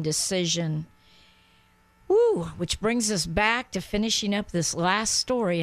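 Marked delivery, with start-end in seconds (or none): 2.1-2.5 high, loud joyful whoop; excited whoop. Real, not acted. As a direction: let out a happy shout. Joyful exclamation on the show.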